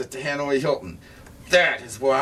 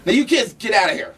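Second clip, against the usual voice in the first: fast, high, loud shout; yelling; 8 semitones higher, 6 dB louder, faster than usual. Acted. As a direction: shoo them out, yelling. Shooing kids away.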